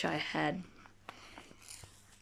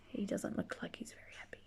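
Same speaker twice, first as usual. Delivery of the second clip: whisper; 7 dB quieter, faster than usual. Real, not acted. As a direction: lean in and whisper the question. Aside to children over book.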